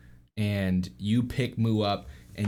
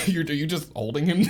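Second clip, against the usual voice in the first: ordinary talk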